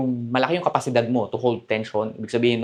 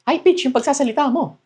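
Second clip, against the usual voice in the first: raised into a high, tight voice; high pitch; 12 semitones higher than usual. Shown as a demonstration. Coach demonstrating a nervous man's voice, pitched high. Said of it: "napupunta ka sa high pitch".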